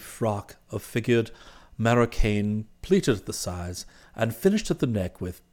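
low, soft, measured valet's report; composed valet Jeeves. Acted. Valet reporting the meeting to his employer, composed and formal. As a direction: report every detail with unruffled formality.

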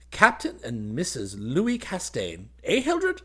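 relishing each word, leaping high at the end; boyish happy chatter. A narrator's reading. Groom trying out the married title on his cousin, gleeful. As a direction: savour the title slowly, then nudge brightly. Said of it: "he rattled on".

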